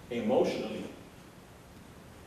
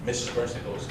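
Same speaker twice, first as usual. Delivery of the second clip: ordinary talk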